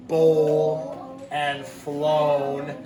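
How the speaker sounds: "bowl and flown" stretched very slowly; stretched long O. Shown as a demonstration. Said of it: "see it in the middle".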